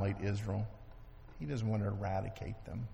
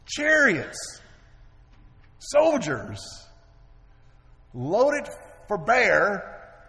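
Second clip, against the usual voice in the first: dramatic buildup; 9 semitones higher, 9 dB louder, much slower than usual. A narrator's reading.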